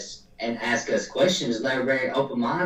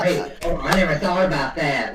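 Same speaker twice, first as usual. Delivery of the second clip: higher-pitched mock dawning surprise; imitated realization; 5 semitones higher, 4 dB louder than usual. Imitating clients hearing the explanation, mock realization. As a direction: act out a client suddenly getting it. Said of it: "they're like".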